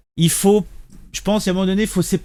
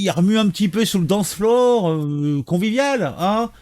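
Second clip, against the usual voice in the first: higher, sing-song mock innocent tone; sarcastic mock innocence; 3 semitones higher than usual. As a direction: paint them as harmless, dripping irony. Sarcastic retelling of the youths. (ironie appuyée, voix plus haute et faussement innocente)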